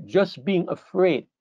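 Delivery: just being afraid drawn out slowly with weight on just; slow it right down to drive it home; preacher lingering on each word; slow emphasis. Shown as a demonstration.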